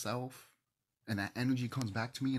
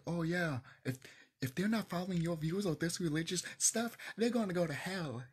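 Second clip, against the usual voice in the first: higher-pitched mock preachy warning; lecturing a kid; 8 semitones higher than usual. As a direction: imitate someone telling a kid off. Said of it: "if you're telling a kid".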